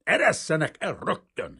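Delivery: seething command; hissed demand. A narrator's reading. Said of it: "sziszegte Kazimír".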